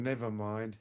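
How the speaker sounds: ordinary talk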